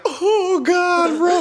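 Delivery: high-pitched drawn-out wail; re-enacted panic. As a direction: wail as if being flung about midair. Acts out panic during maneuvers.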